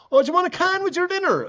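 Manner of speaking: very high, loud motherly holler; mother shouting upstairs. Retold or imitated. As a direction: imitate Mum calling up the stairs. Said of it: "The mother shouts up".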